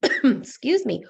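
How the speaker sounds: ordinary delivery, nothing marked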